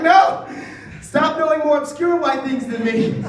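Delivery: loud, high shout of protest; exasperated outburst. Acted. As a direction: blow up at being one-upped. Acted outburst at being one-upped.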